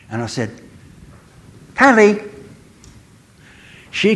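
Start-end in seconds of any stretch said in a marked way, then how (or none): 1.8-2.2 high, loud call of the cat's name. Retold or imitated. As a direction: call out to a pet down the garden. Calling the cat from afar.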